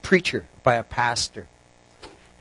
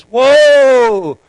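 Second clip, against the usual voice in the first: high, drawn-out shout to halt; long, loud, acted alarm; 9 semitones higher, 9 dB louder than usual. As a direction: yell to rein in a runaway donkey. Worried rider nearing cliff shouts halt.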